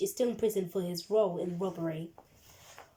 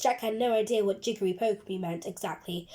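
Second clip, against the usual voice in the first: ordinary delivery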